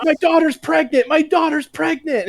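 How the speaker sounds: high, panicky pleading; whiny mock plea. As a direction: beg like a man being cuffed. Agent pleading while cuffed.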